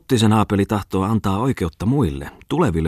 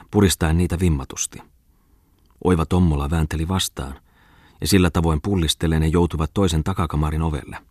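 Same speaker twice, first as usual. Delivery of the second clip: ordinary delivery, nothing marked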